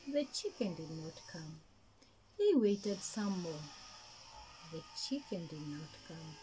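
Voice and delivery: usual speaking voice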